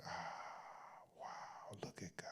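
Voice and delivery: hushed, whispered wonder; whispered awestruck relief; awed hush. Retold or imitated. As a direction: breathe it out in awe, barely audible. Awed reaction retold in a hush.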